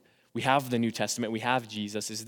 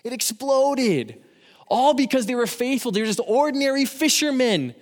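higher, louder impassioned preaching; rousing youth sermon, pitched up and pushed hard; preaching at full force; 8 semitones higher, 4 dB louder than usual. Acted. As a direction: fire up the high schoolers to go and act. Preaching continues, peaking.

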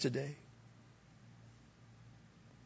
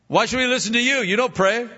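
high, defiant talk-back; defiant kids voice; 8 semitones higher, 4 dB louder, faster than usual. Retold or imitated. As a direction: throw it back at him. Kids quoted talking back.

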